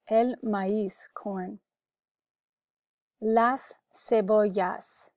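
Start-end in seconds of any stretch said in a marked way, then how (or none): 3.2-5.1 "Las cebollas" said slowly, words apart; slow, deliberate "Las cebollas" for learners; careful pronunciation model. Shown as a demonstration. Teacher gives the word for onions, slow careful model. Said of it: "You can repeat for pronunciation".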